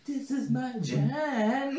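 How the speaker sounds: high, slow mock sing-song. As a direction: parrot it back mockingly. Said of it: "mocking again".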